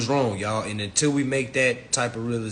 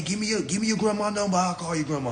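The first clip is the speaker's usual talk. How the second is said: ordinary delivery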